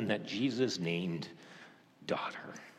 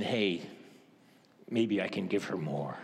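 gentle; 7 semitones lower, 3 dB quieter than usual. Shown as a demonstration. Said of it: "It's Jesus saying".

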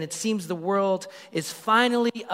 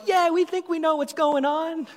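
much higher, airy, oblivious voice; clueless disciples; 11 semitones higher than usual. Retold or imitated. Preacher mocking the disciples who don't get it, high and airy. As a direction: play the disciples as cheerfully missing the point. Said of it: "The disciples are like".

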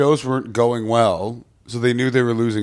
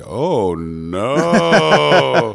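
long drawn-out "oh, no", rising and getting louder; mock dismay; 3 semitones higher, 5 dB louder than usual. Comic reacting to a bad exchange rate, mock dismay. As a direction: groan it out in exaggerated, swelling horror.

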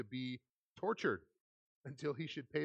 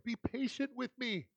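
raised pitch, begging tone in a lightly voiced servant; pleading servant; 10 semitones higher than usual. A narrator's reading. Servant begging the master for time, pleading higher. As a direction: beg for mercy on your knees. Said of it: "he begged".

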